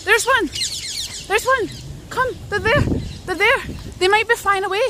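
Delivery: high, loud excited calls; spotting the birds and getting thrilled. Real, not acted. Streamer spotting parakeets in the park, high excited calls.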